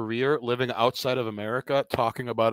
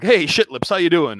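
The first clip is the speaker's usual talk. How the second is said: higher, much louder brash comic heckle; Rodney Dangerfield bit; 5 semitones higher, 9 dB louder than usual. Retold or imitated. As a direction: bark it like a nightclub comic working the room. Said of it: "like Rodney Dangerfield working the room".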